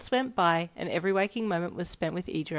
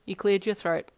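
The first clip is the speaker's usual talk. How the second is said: plain reading voice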